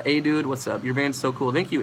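ordinary talk, nothing marked

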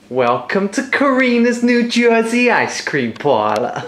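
a shopkeeper badly faking a New Jersey accent. Host greeting ice cream customers, mock New Jersey accent. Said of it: "can you do a New Jersey accent".

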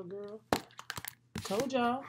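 usual speaking voice

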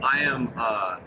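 usual speaking voice

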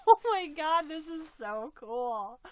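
loud squeal of delight; excited cry. Real, not acted. Opening the doll box, loud squeal of delight. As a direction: let it burst out loud. Overjoyed at the gift.

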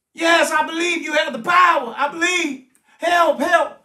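loud, high, insistent cries; desperate pleading. Acted. Hanging man swearing belief to his rescuer, desperate shouting. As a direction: beg urgently to be pulled up.

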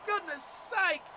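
loud, high, drawn-out groan of frustration; exasperated shout. Real, not acted. Gamer watching his try get held up, exasperated shout. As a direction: yell it like the try was stolen.

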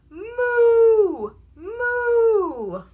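long, high, loud moo; cow moo. A narrator's reading. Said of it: "He can sound like a cow".

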